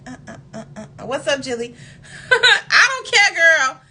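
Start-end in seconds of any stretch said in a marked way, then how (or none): 2.3-3.8 higher, louder, slow call to a friend. Real, not acted. Answering Jilly off-camera, calling out loudly. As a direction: call across the room, stretched out.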